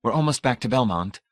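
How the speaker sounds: ordinary delivery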